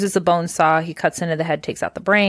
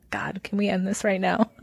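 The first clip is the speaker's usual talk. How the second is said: whispered; 5 dB quieter than usual. Real, not acted. Embarrassed aside.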